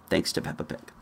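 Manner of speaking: ordinary talk, nothing marked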